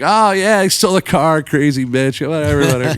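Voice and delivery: playing it cool. Band pretending a stolen car is normal, mock-casual shrug. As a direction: brush it off like it's nothing. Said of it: "we're just playing it cool".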